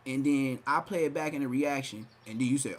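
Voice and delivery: normal talking voice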